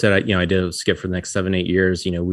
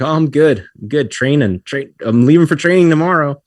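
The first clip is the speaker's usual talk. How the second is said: higher, louder chipper answer; cheerful, raised-voice self-quote; retold upbeat reply; 8 semitones higher, 6 dB louder than usual. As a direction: act out eager good spirits. Retold upbeat reply before bad news.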